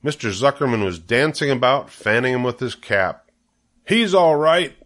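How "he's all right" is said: high, loud insistence; cried out. A narrator's reading. Zuckerman defends his fainted pig to the judges, crying out. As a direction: call it out urgently over the crowd. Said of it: "cried Mister Zuckerman".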